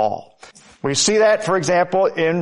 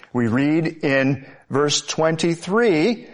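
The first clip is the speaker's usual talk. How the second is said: ordinary delivery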